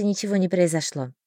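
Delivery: ordinary delivery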